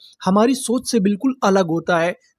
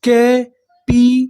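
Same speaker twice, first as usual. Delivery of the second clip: Capitulation drawn out slowly and a bit louder; slow sounding out; 5 dB louder, much slower than usual. Shown as a demonstration. Teacher showing students a new word, slow syllable demo. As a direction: spell capitulation out slowly, piece by piece. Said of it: "तो हमारी पूरी meaning क्या बनी".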